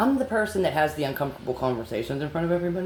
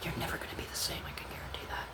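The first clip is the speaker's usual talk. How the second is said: soft, voiceless, threatening hush; hushed warning to the accused coworkers; menacing whisper; 16 dB quieter, faster than usual. Real, not acted.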